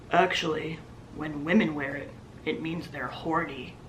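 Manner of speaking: low, soft imitation of a creepy husband; creepy man voice. The husband stares at the waitress, low and creepy. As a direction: say it low and quiet, dead serious. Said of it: "that's how he spoke".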